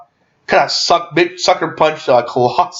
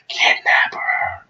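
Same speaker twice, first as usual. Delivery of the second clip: slow, soft whispered aside; sneaky conspiratorial whisper; 5 dB quieter, much slower than usual. Retold or imitated. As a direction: whisper the secret order, drawn out. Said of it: "he's like whispering to me".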